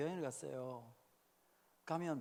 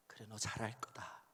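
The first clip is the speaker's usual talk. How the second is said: whisper; 8 semitones lower, 8 dB quieter than usual. Retold or imitated. Old father says it to son.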